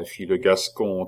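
ordinary delivery, nothing marked

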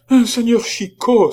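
very high, loud startled cry; the monk cries out in panic; terrified exclamation. A narrator's reading. Frightened monk cries out on seeing Chicot. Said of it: "s'écria-t-il".

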